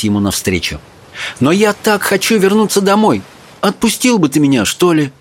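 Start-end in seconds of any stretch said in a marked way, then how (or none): 1.4-5.1 put-on higher, pleading young girl voice; girl begging to go home. A narrator's reading.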